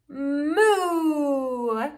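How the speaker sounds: long drawn-out MOO; cow moo. Shown as a demonstration. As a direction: imitate a cow for a child to copy. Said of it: "like a cow?".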